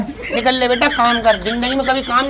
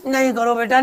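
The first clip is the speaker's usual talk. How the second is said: ordinary delivery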